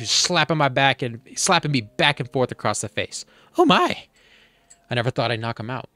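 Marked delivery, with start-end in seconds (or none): 3.6-4.0 a sudden high squeak of shock; startled gasp of surprise. Acted. Bee startled mid-story, high surprised oh my. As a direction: caught off guard, voice jumping way up.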